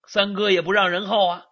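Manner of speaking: ordinary delivery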